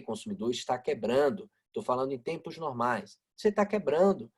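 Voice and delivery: usual speaking voice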